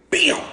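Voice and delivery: high sharp bam; loud bam of a punch; punch sound. Retold or imitated. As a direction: make the impact sound.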